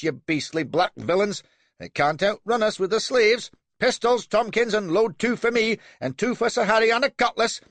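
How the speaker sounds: high, loud, bloodthirsty excitement; boy hero charging the enemy; eager young captain. A narrator's reading. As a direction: urge the crew on, spoiling for a fight.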